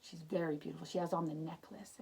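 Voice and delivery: ordinary delivery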